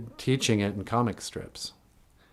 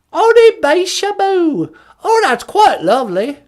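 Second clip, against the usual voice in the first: put-on very high, loud posh British old lady; squeaky mock Queen Mum; Queen of England; 12 dB louder than usual. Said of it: "Queen Elizabeth?".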